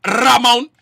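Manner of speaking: Ramon with a long trilled R, loud; rolled R. Retold or imitated. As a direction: roll the R hard at the start of Ramon. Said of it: "he said his name is Ramon".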